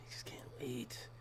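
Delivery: slow breathless whisper of longing; eager whisper. Real, not acted. To viewers about faraway women, a slow eager whisper. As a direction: whisper it like you can hardly stand the wait.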